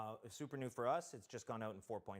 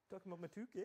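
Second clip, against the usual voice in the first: mock voice; 9 semitones higher, much faster than usual. Teasing mock voice.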